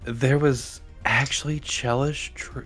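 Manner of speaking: hushed, slow, disbelieving whisper. Acted. Visitor asking the crew about Chelish people, whispering. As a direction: drop to a slow, careful whisper. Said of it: "she's, like, whispering".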